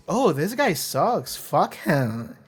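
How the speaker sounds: slow, swooping OH, THIS GUY SUCKS; smitten mock disapproval. Shown as a demonstration. Fan who loves the awful character reacting, smitten mock disapproval. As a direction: say it as if you secretly adore him, falling away at the end. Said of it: "And the other way is".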